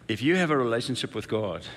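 ordinary talk, nothing marked

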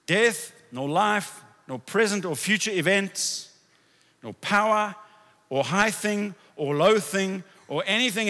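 loud, high, slow scripture declaration; preaching. Acted. Pastor proclaiming scripture to the congregation, fervent and loud. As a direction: proclaim it with fervor.

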